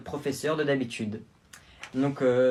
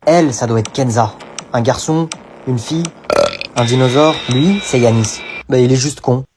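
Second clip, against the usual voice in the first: narration de présentation; 14 dB louder than usual. Acted. Narration présente les élèves.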